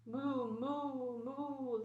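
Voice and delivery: long, drawn-out storybook moos; imitated cow call; cow mooing. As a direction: moo like a cow in a children's tale.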